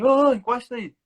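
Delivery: loud, high police holler; retold shout. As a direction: imitate an officer yelling to pull over. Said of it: "ele gritou assim".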